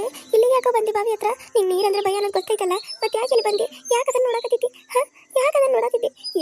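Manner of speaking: high, loud, worried scolding; upset scolding. Acted. Sister scolding her water-shy brother for going near the well, upset. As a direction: demand an answer, pressing harder on the repeat.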